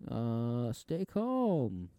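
mock calming Santa impression; movie Santa. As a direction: drawl it soothingly as old Santa. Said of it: "Santa just comes up".

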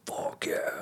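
breathy whisper; whispered fuck yeah. Retold or imitated. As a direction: whisper it eagerly. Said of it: "I was like".

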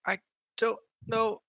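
high, soft terrified denial; frightened girl. Acted. NPC denies again.

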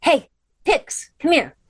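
high, silly calling voice; funny pet-calling voice. Real, not acted. Interrupting the class to call Pixie, funny high voice. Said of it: "using my funny voice to call her".

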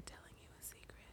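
breathy whisper close to the mic. Shown as a demonstration. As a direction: whisper it like a secret in the ear. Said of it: "It just sounds like I'm".

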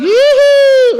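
high, loud whoop with a laugh; joyful whoop. Real, not acted. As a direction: cheer like you've just been forgiven. Husband's joy after forgiveness.

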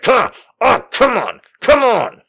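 high, strained grunted urging; mimicked exertion, high and squeezed; straining effort. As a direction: imitate someone heaving with each push. Imitates man straining while pushing.

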